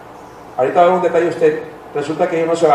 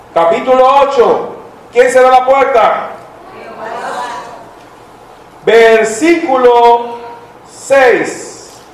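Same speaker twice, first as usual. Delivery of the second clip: raised, louder scripture reading, slowed in places; preaching; 7 semitones higher, 3 dB louder, slower than usual. Acted. Pastor reading Genesis eight aloud, raised preaching voice. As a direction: proclaim the verse.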